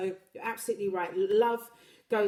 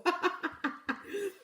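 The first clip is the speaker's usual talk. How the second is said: laughing; 10 semitones higher than usual. Real, not acted. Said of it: "laughing at own accent attempt".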